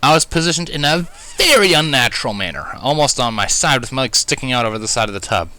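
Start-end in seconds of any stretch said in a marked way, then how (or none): none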